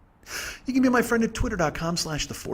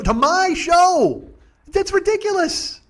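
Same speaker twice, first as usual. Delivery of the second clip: very high, drawn-out shout; outraged disbelief; 13 semitones higher, 4 dB louder, slower than usual. Retold or imitated. Comic appalled a kid hears his show, slow high incredulous shout. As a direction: stretch it into an appalled high yell. Outrage peaks on repeated phrase.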